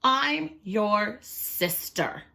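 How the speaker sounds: very slow I'm your sister; slow, earnest hen. Acted. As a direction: spell it out for the crocodile. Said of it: "said Missus Chicken".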